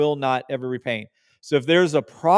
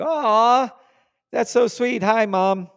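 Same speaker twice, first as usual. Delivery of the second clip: much higher, tender aw; touched; 9 semitones higher, 3 dB louder, slower than usual. Real, not acted. Reading a viewer's note about Mom, touched and tender. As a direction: melt at a kind comment. Reading a sweet chat comment.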